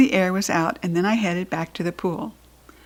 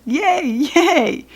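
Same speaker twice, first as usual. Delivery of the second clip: high-pitched, gleeful yays; excited cheering; 8 semitones higher than usual. A narrator's reading. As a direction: cheer like a delighted kid. Child keeps cheering after good news.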